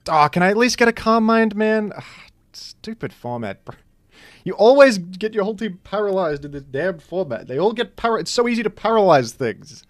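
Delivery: high loud griping at bad luck; frustrated outburst. Real, not acted. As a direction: vent at the stupid format, real frustration. Frustrated at paralysis in battle.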